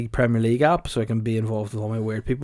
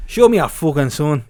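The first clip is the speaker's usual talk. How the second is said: louder mock gangster demand; Tommy Shelby; 2 semitones higher, 7 dB louder than usual. Gangster demanding to see Pricey's son, loud hammed-up order. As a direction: demand it loudly in a put-on accent. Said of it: "I'm Tommy Shelby".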